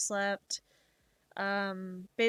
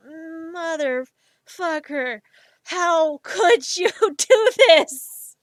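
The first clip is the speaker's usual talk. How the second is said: loud, slow, laughing cry of disbelief; half-laughing mock outrage, dragged out; laughing fake exasperation; mock outburst; 6 semitones higher, 11 dB louder, much slower than usual. Overwhelmed fan reacting to song.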